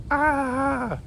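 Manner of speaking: high panicked shriek; a sudden high yelp of horror. Acted. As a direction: ant shrieking in dread. Ant screams at the word rug.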